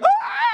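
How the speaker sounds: sudden high scream of fright; startled shriek. Retold or imitated. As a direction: shriek like you just got spooked. Startled woman screams at stranger.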